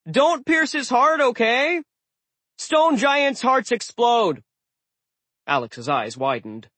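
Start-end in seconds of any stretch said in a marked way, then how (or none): 0.1-4.4 much higher urgent sword voice; high talking sword. A narrator's reading. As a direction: squeal a panicked warning. Magic sword warns urgently.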